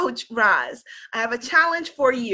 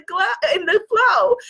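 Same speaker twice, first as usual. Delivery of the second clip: laughing through a slip; 7 semitones higher than usual. Real, not acted. Laughs at her own slip.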